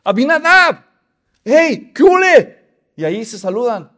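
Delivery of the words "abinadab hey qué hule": high, loud calling out between brothers; brothers greeting. Acted. Soldier brothers calling out to each other in greeting. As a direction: hail your brothers across the camp.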